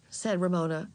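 plain reading voice

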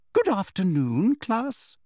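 high, sugary singsong greeting; prim high-pitched schoolmistress; smug teacher. A narrator's reading. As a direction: lilt it upward with sweet condescension.

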